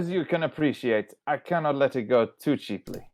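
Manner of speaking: put-on a light, playful French accent as the haggling merchant. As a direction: haggle like a mild French sea trader. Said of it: "Wait, is Mama Tuk Tuk French?".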